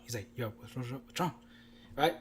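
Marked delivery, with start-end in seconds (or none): 0.4-1.3 low, very soft check-in; quietly imitating a worried cousin; hushed concern. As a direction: ask it under your breath, discreetly.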